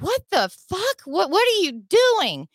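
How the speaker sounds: high-pitched, outraged retelling; shocked protest. Retold or imitated. Driver to cop after being thrown down, shocked protest. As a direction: relive the disbelief, voice shooting up.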